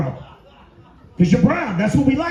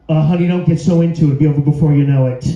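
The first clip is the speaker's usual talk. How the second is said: louder mocking ex voice, quickening at the end; ex through the wall; 5 semitones lower, 5 dB louder, faster than usual. Ex-girlfriend mocking the new girl through the wall, louder and taunting. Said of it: "this voice coming through the wall".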